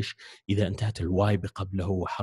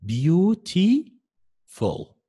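beautiful stretched very long and higher; drawn-out demo word; 8 semitones higher, 4 dB louder, much slower than usual. Shown as a demonstration. Teacher to students, counting syllables by stretching the word. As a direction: draw out every syllable of beautiful slowly. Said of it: "جميل".

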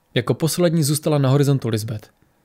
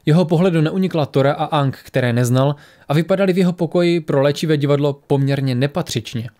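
plain reading voice

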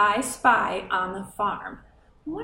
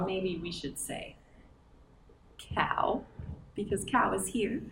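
whispered; 3 semitones lower, 9 dB quieter than usual. Acted. Whispered aside.

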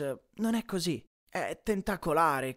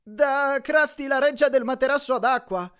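"del materasso ad acqua" said clearly in a high, louder Grover voice; Grover translating; 8 semitones higher, 7 dB louder than usual. Acted. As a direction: correct the sign brightly in a higher voice. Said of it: "tradusse Grover".

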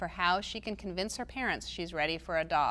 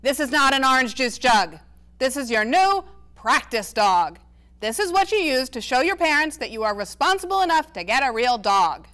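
exasperated grandpa; 4 semitones higher, 9 dB louder than usual. A narrator's reading. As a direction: throw up your hands at her. Grandpa Ace voiced in reading.

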